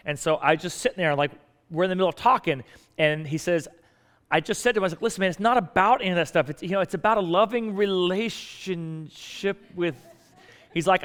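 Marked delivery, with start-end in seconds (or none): 5.0-10.0 high, insistent preacher line slowing right down; quoting the pastor. Retold or imitated. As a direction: imitate a pastor's catchphrase, stretching the last words. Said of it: "I started quoting you verbatim".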